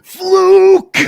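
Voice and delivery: high, loud, strained shout of one word; imitating Roode's yell. Roode shouting at Aries in the ring, imitated furious yell. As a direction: bark it at his face like a sore loser. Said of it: "he just goes".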